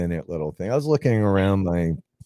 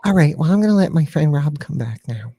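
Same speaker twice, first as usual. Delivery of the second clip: put-on little girl voice; 6 semitones higher than usual. Puppet Annabelle voice.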